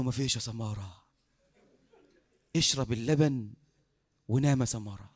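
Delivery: low, unhurried reassurance; soothing sheikh. Acted. Sheikh telling drowsy Samara to sleep, low and soothing.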